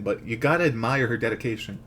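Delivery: ordinary delivery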